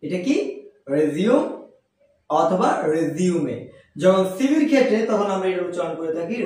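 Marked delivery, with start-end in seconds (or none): 1.0-1.4 রিজিউম said "rez-yum"; রিজিউম with a buzzing z; correct pronunciation. Shown as a demonstration. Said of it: "এর উচ্চারণ হচ্ছে". 2.9-3.6 রিজিউমে said "rez-yu-me"; রিজিউমে with z and "me" ending; alternative correct pronunciation. Shown as a demonstration. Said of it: "এর উচ্চারণ হচ্ছে".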